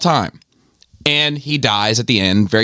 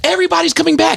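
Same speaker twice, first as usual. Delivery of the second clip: much higher near-yell of disbelief; exasperated shout; faster than usual. Real, not acted. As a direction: shout it at the whole fandom. Exasperated rant at fans.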